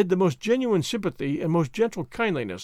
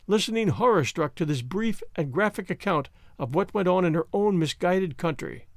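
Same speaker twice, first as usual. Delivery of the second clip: usual speaking voice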